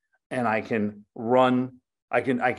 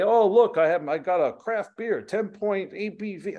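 mock show-off poster; 8 semitones higher than usual. Mocking a beer-bragging Instagram poster, smugly showing off. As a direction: parody someone bragging about a craft beer.

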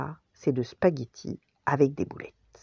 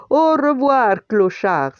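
high, loud storybook farewell; calling out; 8 semitones higher, 8 dB louder, slower than usual. A narrator's reading. Tony calls to the retreating dog, shouting cheerfully. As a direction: call after someone leaving. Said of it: "s'écria-t-il".

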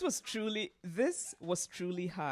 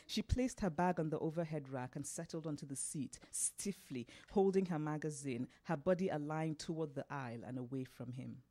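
ordinary delivery, nothing marked

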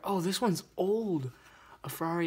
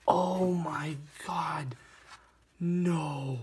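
slow breathless whisper; stunned whisper of joy; awed whisper; much slower than usual. Real, not acted. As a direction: hush it out in disbelief. Holding the Bugatti.

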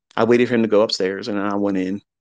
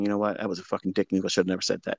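softer, a touch of broken-down tears; tearful apology; 3 semitones lower, 8 dB quieter, much faster than usual. Retold or imitated. Imagined younger self apologizing, softly tearful. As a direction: quietly own up, close to crying. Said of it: "broke down and started crying and saying".

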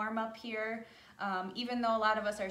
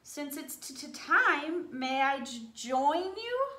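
high, stammering little voice; stuttering squirrel; 3 semitones higher, 4 dB louder, slower than usual. Acted. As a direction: trip over the t and j nervously. Stuttering squirrel asks to join.

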